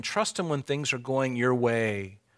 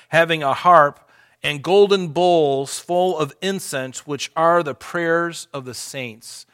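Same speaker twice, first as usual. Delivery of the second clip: loud, raised reading of Revelation, slowing at the end; forceful scripture reading; 3 semitones higher, 5 dB louder than usual. Acted. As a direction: proclaim the heavenly scene. Preacher reads Revelation aloud.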